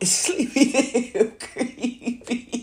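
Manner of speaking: slow, laughing through the name. Real, not acted. Mocking his nickname while laughing.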